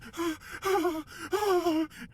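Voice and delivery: high, wheezy repeated gasps; acted panic breathing; imitated hyperventilating. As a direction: gasp in long panicked shudders.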